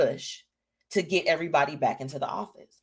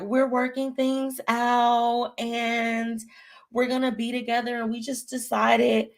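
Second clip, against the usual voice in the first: mock reality star; 6 semitones higher, slower than usual. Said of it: "was just like".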